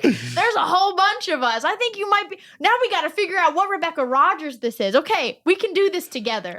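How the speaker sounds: high, loud and laughing through it; playing along cheerfully to waste his time; mock helpful enthusiasm. Rebecca teases the scammer about other Rebecca Rogers, laughing, high and loud.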